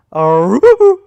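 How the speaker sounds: high, very loud exaggerated cry; dad's patented sound effect. Shown as a demonstration. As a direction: belt out dad's trademark noise. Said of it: "let me see if I can do it right".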